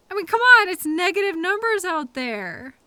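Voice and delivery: loud, high burst of exasperation; exasperated yell. Acted. Driver losing patience with stubborn coworker. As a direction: throw up your hands and yell it. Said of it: "I'm sorry I yelled".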